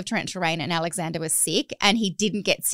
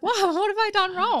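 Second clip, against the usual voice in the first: much higher, mock-shocked gasp of a line; 11 semitones higher than usual. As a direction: play shocked and guilty. Mum's shocked reaction retold.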